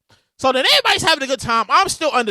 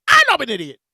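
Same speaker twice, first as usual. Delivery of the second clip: ordinary delivery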